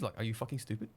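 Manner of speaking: usual speaking voice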